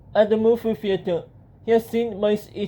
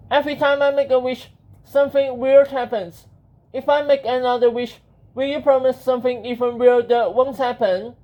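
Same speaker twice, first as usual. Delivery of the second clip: higher-pitched, frustrated hero voice; Benjamin; 4 semitones higher, 4 dB louder than usual. Acted. Hero complaining to the genie about wishes, high voice. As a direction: plead in a raised, higher character voice.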